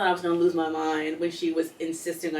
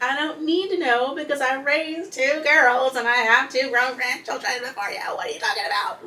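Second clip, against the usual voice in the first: higher, indignant mother voice; imitating her mom; 4 semitones higher, 4 dB louder than usual. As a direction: mimic mom brushing her off. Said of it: "And she's like".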